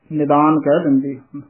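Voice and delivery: ordinary talk, nothing marked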